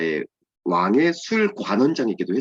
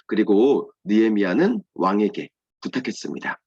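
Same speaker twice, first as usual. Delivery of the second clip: ordinary talk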